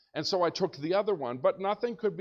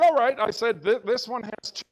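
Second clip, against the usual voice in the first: excited reply; 6 semitones higher, 8 dB louder than usual. Retold or imitated. Answering Mr. Lopez excitedly.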